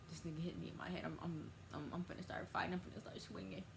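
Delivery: soft angry grumble; muttered anger. Retold or imitated. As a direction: mutter threats under your breath. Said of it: "'cause of how mad I was".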